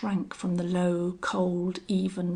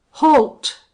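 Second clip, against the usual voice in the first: loud, high, abrupt halt order; explosive raised command; commanding shout; 7 semitones higher, 14 dB louder than usual. A narrator's reading. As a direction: shout to stop him dead. Wade stops man turning away.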